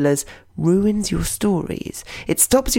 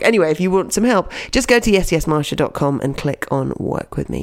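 usual speaking voice

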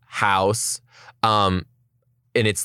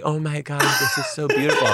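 lightly hammed-up awe, a bit higher; feigned wonder; 5 semitones higher, faster than usual. Girlfriend admires view awaiting proposal, feigned wonder. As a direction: gush as if you suspect nothing.

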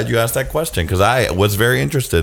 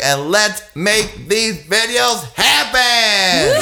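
excited sign-off; 6 semitones higher, slower than usual. Real, not acted. Rousing sign-off cheer.

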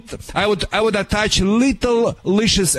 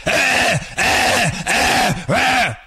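higher barking yaps, imitating the dogs; rapid mock dog barks; 6 semitones higher, much slower than usual. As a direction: bark like gunfire. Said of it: "like Russian A K forty-seven".

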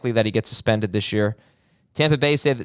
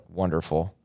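low, soft deadpan sarcasm; dry, deflated mock approval; sarcastic; 6 semitones lower, 6 dB quieter than usual. Real, not acted. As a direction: say it quietly, meaning the opposite. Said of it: "that was sarcasm".

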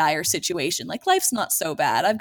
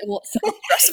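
higher, hammed-up whine; mock whiny complaint; 13 semitones higher than usual. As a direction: pretend to fret for a laugh.

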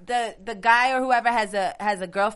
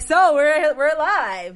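louder, higher show-opening voice; on-air host; 5 semitones higher, 7 dB louder, much slower than usual. Retold or imitated. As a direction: switch on the live presenter projection. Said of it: "get on the show and be like".

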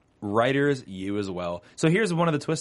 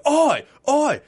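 much higher, louder Cockney "Oi, oi"; Vinnie Jones Cockney; 9 dB louder than usual. Retold or imitated. The host mimics Vinnie Jones with a loud Cockney shout. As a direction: shout it like a London hard man. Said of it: "start cussing like Vinnie Jones does".

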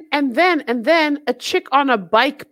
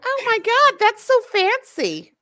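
higher gushing "oh my God"; mock gushing; 10 semitones higher than usual. People who hear the words acid casualty gush in a high voice. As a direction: gush over it like an impressed fan. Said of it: "They're like".

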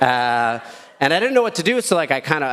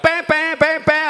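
high-pitched mock honking; imitated car horns; 14 semitones higher, slower than usual. Comic retelling oncoming traffic, blaring imitated horns. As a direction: honk like a line of angry cars. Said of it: "all the oncoming traffic was like".

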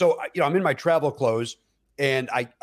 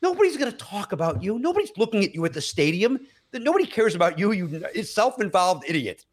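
higher, exasperated inner rebuke; scolding himself; 6 semitones higher than usual. Retold or imitated. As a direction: tell yourself off, pitched up. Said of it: "And then I was like".

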